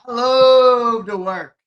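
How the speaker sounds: slow, loud, drawn-out; hammed-up proclamation. Streamer hyping up his work session, hammed-up proclamation. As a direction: belt it like a motto.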